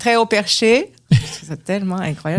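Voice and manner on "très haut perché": put-on high-perched sixties Radio-Canada accent; lightly mimicked vintage Radio-Canada accent, higher; old Radio-Canada accent. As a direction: imitate a sixties Radio-Canada announcer, pitched up. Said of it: "les accents de Radio-Canada des années soixante".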